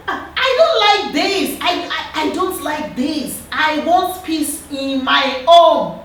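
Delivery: put-on high, slow child's voice, half laughing; upset little girl. Daughter begging her shouting parents for peace, high and slow. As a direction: plead like a small girl who wants peace.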